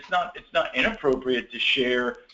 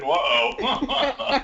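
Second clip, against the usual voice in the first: mock surprise; 9 semitones higher than usual. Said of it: "were both thinking".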